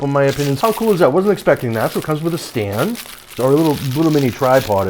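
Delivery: ordinary talk, nothing marked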